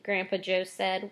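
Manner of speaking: plain reading voice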